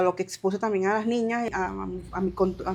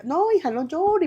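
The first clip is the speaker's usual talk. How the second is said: comforting coffee seller; 7 semitones higher than usual. Retold or imitated. Coffee seller comforting crying migrant.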